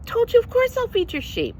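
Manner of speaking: high, fast exasperated Peter. Retold or imitated. Peter to Jesus, exasperated at the third question. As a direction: rattle it off, baffled and high. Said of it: "Peter's like".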